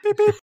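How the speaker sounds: high, loud mock beep; beep noise. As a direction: say it like a machine beeping. Imitating a beep sound.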